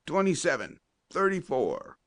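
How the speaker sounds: high-pitched quarterback's call, falling at each end; calling out signals. A narrator's reading. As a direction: sing out the play numbers to the team. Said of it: "cried Colton".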